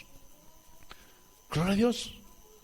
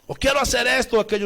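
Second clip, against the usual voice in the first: mock stubborn demand; 5 semitones higher, 5 dB louder, faster than usual. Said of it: "Dios no es así".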